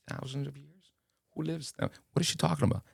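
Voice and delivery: high, hushed disbelief; incredulous aside. Retold or imitated. Newcomer privately doubting the lady's family history, high, soft and incredulous. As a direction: doubt it under your breath, pitched high.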